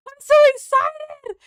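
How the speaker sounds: high, loud, squealing I'm so excited. Real, not acted. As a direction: burst with excitement. Squealing over the new chapter.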